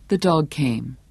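ordinary delivery

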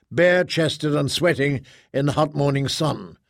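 ordinary delivery

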